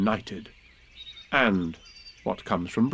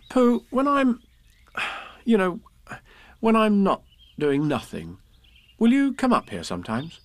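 put-on high child's voice, halting; Christopher Robin, hesitant boy; 11 semitones higher than usual. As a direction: struggle to ask as a small boy. Story figure Christopher Robin.

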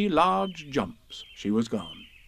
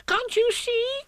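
very high squeaked shout; tiny creature's high-pitched shout; slower than usual. A narrator's reading. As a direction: squeal it in protest. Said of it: "shouted Piglet".

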